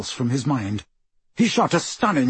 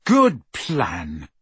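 sarcastic praise, lightly lowered; fake-impressed, dry; mock-admire their plan; mock admiration; 6 semitones lower than usual. A narrator's reading. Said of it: "said Harry in mock admiration".

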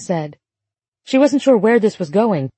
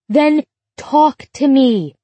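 shouting; 6 semitones higher, 3 dB louder, slower than usual. A narrator's reading. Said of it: "Emma shouted".

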